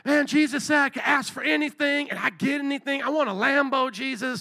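high, excited put-on voice; eager greedy believer. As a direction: play someone thrilled to get anything they ask. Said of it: "some people probably would have been like".

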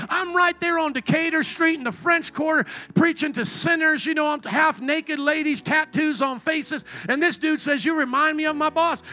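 excited retelling. Retold or imitated. Man on the street telling the preacher about his boss, eager and high. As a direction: gush about the boss like a fan.

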